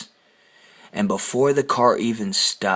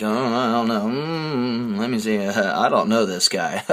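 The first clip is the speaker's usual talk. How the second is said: feigned cluelessness. Retold or imitated. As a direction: imitate someone playing dumb, disowning a colleague. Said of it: "he turned around and act like".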